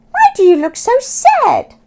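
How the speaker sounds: high, loud cheerful squawking bird; friendly seagull. Acted. Sammy the Seagull greeting Oscar, high cheerful squawk. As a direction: chirp it brightly like a friendly gull.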